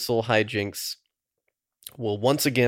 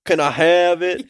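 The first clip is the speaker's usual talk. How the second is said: loud, high, drawn-out pleading; exaggerated comic begging; skit dude impression; 7 semitones higher, 8 dB louder than usual. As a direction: imitate a sketch character pestering someone, slow and loud. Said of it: "a dude in a movie theater just asking this girl".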